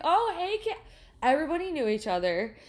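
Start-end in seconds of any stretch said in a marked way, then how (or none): 0.0-0.8 much higher mock greeting; gushing hello. Everyone in the diner greeting, high chirpy voice. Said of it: "everyone's like".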